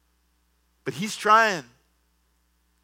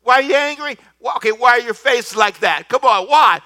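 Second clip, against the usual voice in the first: loud, higher pleading that ends in a shout; God pleading; 7 semitones higher, 7 dB louder than usual. Retold or imitated. God pleading with sulking Cain, loud and high. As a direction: beg him to answer, building to a shout. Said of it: "The Lord speaks to him and says".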